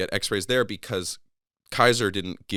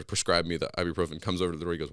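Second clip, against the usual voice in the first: ordinary talk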